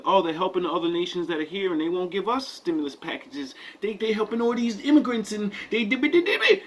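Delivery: high, rapid grumbling that rises into gibberish; hammed-up complaint in a quick, high voice; mock complaining. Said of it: "we've been complaining".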